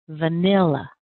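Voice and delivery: "Vanilla" with a long, strong middle syllable; "Vanilla", middle syllable stretched and punched, weak ends; stress on second syllable. Shown as a demonstration. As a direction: lean on the NIL of "Vanilla". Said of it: "where do you hear the longest and strongest syllable".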